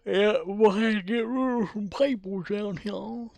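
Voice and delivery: high, garbled cartoon voice; hammed-up high comic mumble; silly character voice. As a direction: put on a goofy high voice. Repeats own remark in silly voice.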